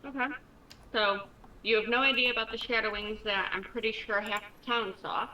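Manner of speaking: ordinary delivery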